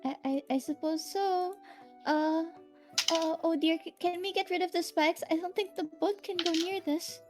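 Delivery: nervous fretting character; timid nervous voice. Acted.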